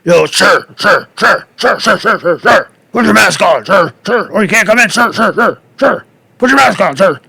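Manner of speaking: high, pushy repeated calls of sir; mock a guard badgering a customer; imitating a door guard. Guard at Whole Foods nags a maskless shopper, mock insistent.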